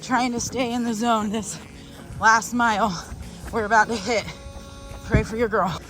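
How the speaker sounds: usual speaking voice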